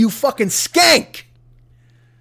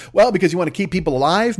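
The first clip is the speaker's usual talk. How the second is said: ordinary talk, nothing marked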